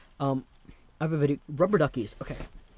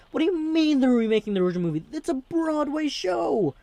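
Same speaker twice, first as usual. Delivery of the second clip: exasperated; 13 semitones higher, 5 dB louder than usual. Real, not acted. Vlogger arguing with musical haters, high indignant outburst. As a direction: throw your hands up at the critics.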